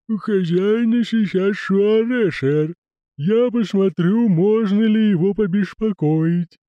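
slow, high creaky lisping servant; creaking groaning door voice. Acted. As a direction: drawl it like a creaky manservant.

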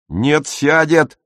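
put-on a boy's stubborn shout; yelling Otto. A narrator's reading. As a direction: shout it back, dragging it out. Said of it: "заорал Отто".

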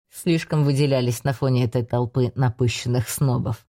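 put-on low, amused old mentor; older man voice. A narrator's reading. Reinard answers in low voice.

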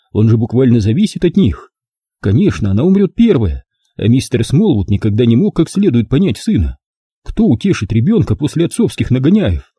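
ordinary delivery, nothing marked

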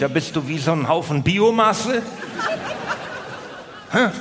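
normal talking voice

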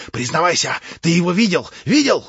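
громко и всё выше; добейся ответа криком; требовательный крик. A narrator's reading. Иван допрашивает в исступлении. (исступлённый допрос)

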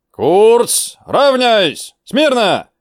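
high, loud parade-ground shout; barked drill commands. Acted. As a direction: bark each command at the ranks.

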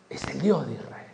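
hushed whisper; whispering the people's confession. Acted. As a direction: breathe it out in a whisper. Acclamation trailing off.

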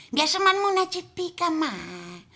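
put-on sneaky murmur of a small boy; little boy voice. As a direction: Juanico peeking quietly. Boy peeks, quietly.

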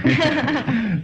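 short burst of high giggling; high chuckle. Acted. Man amused by his visitor, high chuckle. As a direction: chuckle at a joke.

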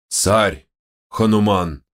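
«Хануман» growled proudly, falling firm. A narrator's reading. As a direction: correct him with a proud roar on «Хануман». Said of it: "гордо выпрямившись, рыкнул Ванар".

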